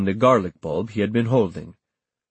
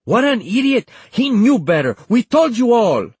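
loud, high-pitched furious outburst; exasperated, raised-voice tirade; angry rant; 14 semitones higher, 6 dB louder than usual. A narrator's reading. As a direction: rant at them, voice way up. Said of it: "Pacing, she ranted".

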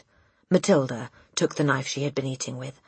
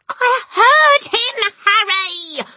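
slow, high, loud wail of fear; quaking shriek; 10 dB louder, much slower than usual. A narrator's reading. Mother insists to husband, frightened shriek. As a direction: shriek it, still terrified. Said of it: "the mother shrieked, still quaking".

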